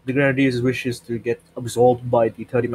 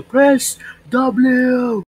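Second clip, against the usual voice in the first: high, very drawn-out mimicry; mocking echo; 10 semitones higher than usual. Player echoing teammate's "press W", high mocking drawl. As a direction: stretch it high, imitating the teammate.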